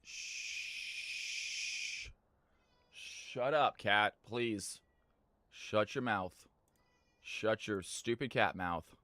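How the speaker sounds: low hushed telling-off to a cat; hushed scolding. Real, not acted. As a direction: scold the cat under your breath. Telling off cat mid-stream.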